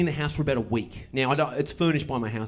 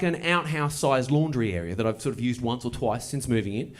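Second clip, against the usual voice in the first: normal talking voice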